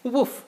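a single high, loud woof; puppy bark. Acted. Dad reading a bedtime book, barking as Biscuit. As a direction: yap once like a happy puppy.

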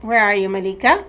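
ordinary talk, nothing marked

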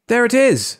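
high, loud cry of discovery; excited call. A narrator's reading. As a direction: call out the sighting. Crowd member spots the rocket.

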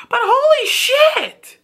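high, slow squeal of shock. Real, not acted. Fan squealing over a brutal breakup scene, high shocked. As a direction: squeal it like the breakup hurt you.

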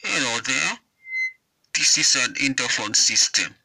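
ordinary talk

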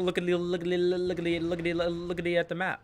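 playful babbling. Pretend. Streamer going to check the map, playful monotone babble.

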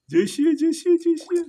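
very high, loud squeaky cheering; excited cheer. Real, not acted. Excited turtle, owner cheers.

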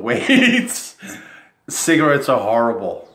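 ordinary delivery

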